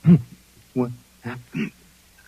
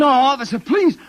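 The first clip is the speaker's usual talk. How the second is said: high, louder, panicked plea; desperate cry; 6 semitones higher, 6 dB louder than usual. Acted. Drugged man's last plea to the officer, panicked cry. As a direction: cry out as they take you.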